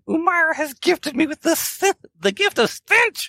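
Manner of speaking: very high, hammed-up declaration; mock-grand proclamation. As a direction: proclaim a divine gift in a high-pitched voice. Player proclaims divine gift in character.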